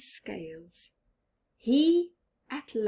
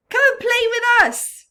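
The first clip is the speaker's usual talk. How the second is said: much higher, louder fast call; 11 dB louder, faster than usual. Acted. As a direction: shout the invitation across the reef. Fish call to Rainbow Fish.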